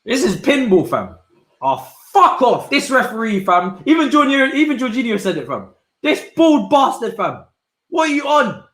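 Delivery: loud high-pitched frustrated rant at the referee; exasperated football fan yelling; angry rant. Real, not acted. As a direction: vent at the ref, pitch up and loud. Ranting at referee during match.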